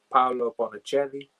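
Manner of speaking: put-on a light, unplanned Italian accent on the name. Reading out the Italian cast list, light Italian accent. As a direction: slip into a hint of an Italian accent. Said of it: "said that in an Italian accent".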